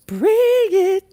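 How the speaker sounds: loud squeal leaping very high; rising squeal. Real, not acted. Streamer daring raiders to come, rising squeal. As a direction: swoop up into a playful shriek.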